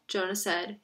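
plain reading voice

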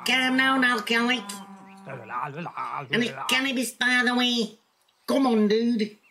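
high put-on character voice; scolding associate. Crook telling the drinker to calm down, high chiding voice. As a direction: pitch it up, chiding a partner.

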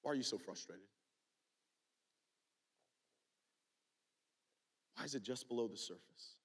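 soft, low, tender questions; gentle questioning. Acted. God asking the angry listener, soft and tender questions. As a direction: ask softly, like a caring father. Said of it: "he says".

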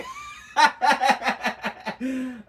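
a long, high burst of laughter; a real laugh. Real, not acted. Laughing at the joke about the husband.

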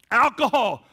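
loud, high, driving testimony; full-force preaching. Acted. Preacher telling of being freed from alcohol, full-force preaching. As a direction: testify at full volume to the room.

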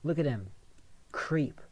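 ordinary talk

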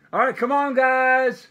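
high, loud call to settle the dogs. Real, not acted. Owner calls upstairs to his barking dogs, loud and high. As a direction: shout up at the noisy dogs, half pleading.

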